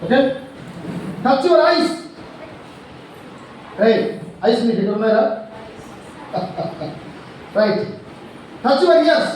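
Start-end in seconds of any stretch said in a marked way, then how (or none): 1.3-1.9 high, loud classroom command; game leader shouting an instruction; called-out command. Real, not acted. Teacher calling commands in game. 8.7-9.3 high, loud classroom command; game leader shouting an instruction; called-out command. Real, not acted. Teacher calling commands in game.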